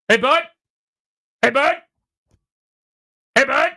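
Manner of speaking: loud, high call across the bar. Retold or imitated. Guy across the bar calls out to him, loud and high. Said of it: "says to him in this tone".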